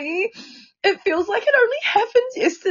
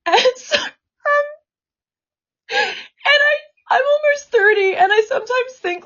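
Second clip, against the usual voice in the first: much higher, tearful voice; sobbing pauses between halting phrases; crying; 8 semitones higher than usual. Real, not acted. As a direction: struggle to speak through real tears. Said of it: "I'm fucking bawling".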